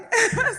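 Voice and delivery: short high laugh through tears; a burst of laughter to cover tears; teary laugh. Real, not acted. Host to audience, a teary laugh. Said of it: "without crying".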